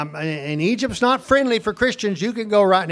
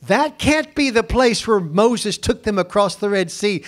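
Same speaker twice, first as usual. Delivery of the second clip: usual speaking voice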